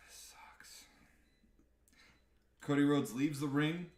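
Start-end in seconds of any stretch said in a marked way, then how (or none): 0.1-0.8 whispered, deflated aside; dejected whisper. Retold or imitated. Cody losing his fake smile, dejected whisper. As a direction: breathe it out like a crushed wrestler. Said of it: "you can see Cody Rhodes go from".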